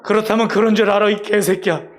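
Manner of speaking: an angry growl at the cub; angry father. Acted. Father tiger losing patience with his doubting cub, angry shout. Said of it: "그 아빠 호랑이 화가 났어요".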